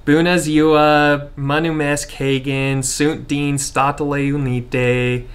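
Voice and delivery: deliberately clumsy American-accented Romanian. Shown as a demonstration. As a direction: spell out each Romanian word like an American beginner. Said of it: "my best attempt at, like, a new American accent".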